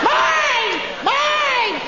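put-on very high-pitched toddler screech; mock a small child shrieking over a toy; piercingly high, drawn-out possessive yell; yelling three-year-old. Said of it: "this child pulling on something and yelling".

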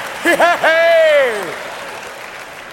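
high, long whooping laugh. Acted. Comic cheers for the good old boys, whooping laugh. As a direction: cackle high and loud, drawing it out.

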